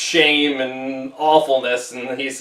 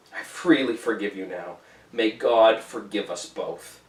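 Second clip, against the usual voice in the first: deep sadness; 6 semitones lower, 5 dB quieter than usual. A narrator's reading. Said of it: "deep utterance out of the abyss of sadness".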